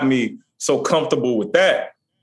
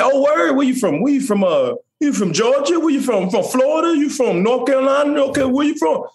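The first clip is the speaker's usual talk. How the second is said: quizzing voice, pitched well higher; a hint of nosy strangers, higher and eager; curious people asking; 10 semitones higher than usual. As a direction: lightly mimic folks grilling him on where he's from. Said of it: "They're like".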